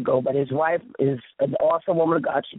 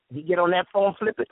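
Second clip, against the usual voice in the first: normal talking voice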